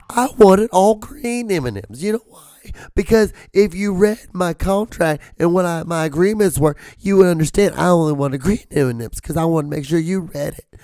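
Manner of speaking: very high, cracking mock outrage; hammed-up, high-pitched tantrum; squealing diva tirade. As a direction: squeal it like a star enforcing the contract. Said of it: "Now when he squeals like that".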